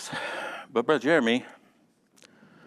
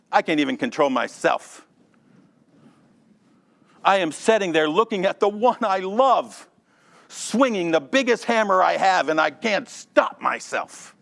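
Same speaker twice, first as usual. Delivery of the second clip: a raised, pleading voice for a man who can't stop; mock confession; 8 semitones higher, 5 dB louder than usual. Preacher acting a man confessing his anger, pleading. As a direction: voice the angry man begging Ben for help.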